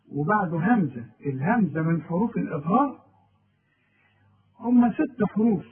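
ordinary delivery

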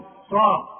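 صا- alone, loud, with a thick ص; isolated emphatic syllable. Shown as a demonstration. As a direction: drill صا- on its own, heavy. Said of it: "مش سايم بقى".

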